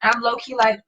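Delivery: ordinary delivery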